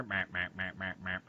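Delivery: low, blaring alarm noise in repeated short bursts; mimicked alarm buzzer; imitated fire alarm.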